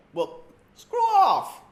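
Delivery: high, loud, slow dismissal; mock shout. Mocking a rich quarterback.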